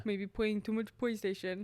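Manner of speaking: lightly put-on smug basement dweller; a hint of a smug neckbeard voice. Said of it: "basement dwelling voice".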